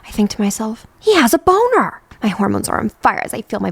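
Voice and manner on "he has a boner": high, loud shocked realization; shocked inner voice in bed; shocked thought. Acted. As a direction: gasp it in disbelief.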